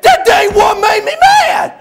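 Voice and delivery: hammed-up tongue-tied roar; Buddy. Buddy corrects the preacher, bellowing in worked-up rage. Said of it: "when he gets real worked up".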